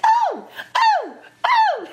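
very high, loud falsetto whoops; imitated high yelps. Actress imitates co-star chased with a whip, yelping. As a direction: yelp in mock alarm, high and loud.

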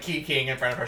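normal talking voice

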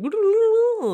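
squealing laugh; a sudden high giggle; high burst of laughter. Real, not acted. Laughing while telling fall.

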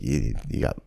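low, soft, menacing bouncer warning; low tough guy. Retold or imitated. Bar patron warning the bouncer, in a low menacing voice. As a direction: growl it low at the man touching a shoulder. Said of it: "and he's like".

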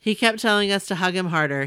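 ordinary talk, nothing marked

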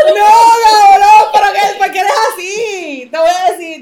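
high, loud wailing complaint; shouted protest. Real, not acted. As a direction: yell it in whiny outrage at a friend. Protests at a friend over the game. (protesta a gritos, casi llorando)

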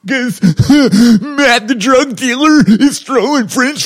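high, loud, slow mock wail; hammed-up crying. Bullied freshman telling his dad, hammed-up crying. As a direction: bawl like a bullied kid, exaggerated. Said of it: "I just bust out crying".

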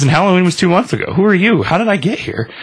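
high, fast frantic questions; panicked guy. Retold or imitated. Confused man to the reaper, a high frantic voice.

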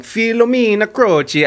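lightly hammed-up Italian accent; a touch of stagey Italian accent. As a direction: say the name with a mock Italian lilt. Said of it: "I keep doing the accent".

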